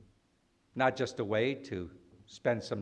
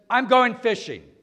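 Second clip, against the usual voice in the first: higher and louder, a firm announcement; emphatic declaration; 10 semitones higher, 8 dB louder than usual. Retold or imitated. Peter telling the disciples he quits, emphatic and loud. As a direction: declare it like a life-changing decision, going hit hard. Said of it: "the way Peter says it is very emphatic".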